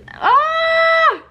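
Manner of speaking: high, sudden wail of grief; anguished cry. Real, not acted. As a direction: let out a high "ah" of despair. Overwhelmed by sad book.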